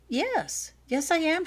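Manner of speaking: high, eager yes; eagerly. A narrator's reading. Sick girl eagerly answers the nurse, high and bright. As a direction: jump at the offer of food. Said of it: "she replied eagerly".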